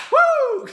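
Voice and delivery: high-pitched celebratory woo; high cheer woo. Real, not acted. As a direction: cheer like a fan. Cheering Bayley's win.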